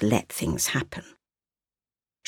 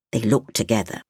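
ordinary delivery, nothing marked

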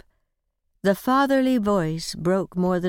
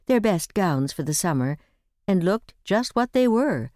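usual speaking voice

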